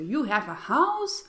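incredulous question landing on house; surprised question. Shown as a demonstration. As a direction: ask with surprise, pitch lifted on house. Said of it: "I'm asking with surprise".

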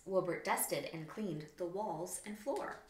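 plain reading voice

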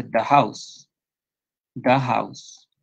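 "the house" with "the" said "da"; "the" as a short "da" before "house"; plain the. Shown as a demonstration. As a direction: say "the" as "da" in "the house", slow. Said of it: "Pero su pronunciación es normal".

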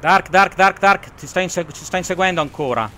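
high, loud panicked calling for help; frantic, hunted, calling his wingman; being chased. Real, not acted. Enemy chasing him, calls partner.

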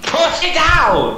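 drawn-out pleading yell; urgent shouting. Real, not acted. Yelling at teammate.

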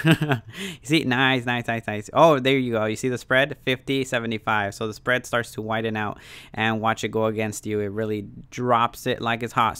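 normal talking voice

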